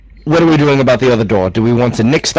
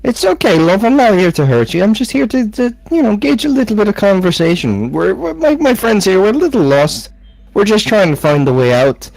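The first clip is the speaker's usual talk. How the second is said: much higher, gentle coaxing voice; soothing; 7 semitones higher than usual. Acted. Soothing a frightened water creature.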